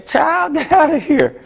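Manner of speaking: high, scoffing brush-off with a laugh; dismissive friend. Acted. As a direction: wave her off, laughing. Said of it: "and you say".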